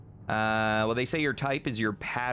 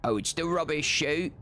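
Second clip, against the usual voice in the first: put-on British accent, pitched up; mock British; 6 semitones higher than usual. Streamer joking with chat about the British, mock British accent. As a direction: do a cartoonish British voice.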